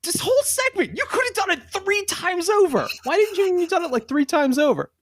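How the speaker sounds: high, pleading mock outrage; mock exasperated rant. Co-host badgering listeners to leave stars, mock-exasperated.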